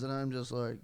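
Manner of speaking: normal talking voice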